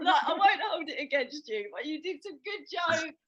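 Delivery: usual speaking voice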